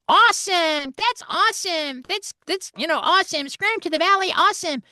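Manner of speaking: very high, loud, excited American; over-the-top cartoon dude; US Scrambler voice. Actor showing the US Scrambler line, very high and loud. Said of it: "Americans don't say wicked".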